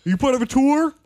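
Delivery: high, loud outraged shriek; Michelle Obama. Pretend. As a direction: yell at the intruder, high and loud. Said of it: "I am now Michelle Obama".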